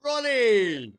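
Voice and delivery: high, loud drawn-out shout of greeting; big falling call. Real, not acted. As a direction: holler the name with delight. Calling out to viewer joining.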